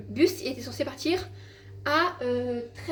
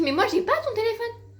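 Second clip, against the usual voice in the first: mother's reply; 10 semitones higher, 4 dB louder than usual. Retold or imitated. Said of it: "Elle me dit".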